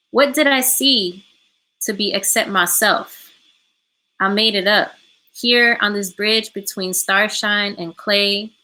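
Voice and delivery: measured recital with long pauses between lines; slow poem reading. Real, not acted. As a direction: read the poem slowly, phrase by phrase, for interpreters. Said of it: "gonna read it slow for our interpreters".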